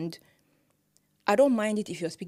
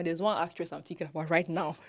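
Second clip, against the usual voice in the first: ordinary delivery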